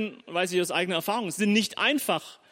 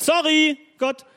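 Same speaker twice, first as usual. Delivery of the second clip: breezy sorry; 9 semitones higher, 6 dB louder, slower than usual. Retold or imitated. David's casual apology to God.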